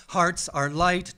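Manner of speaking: plain reading voice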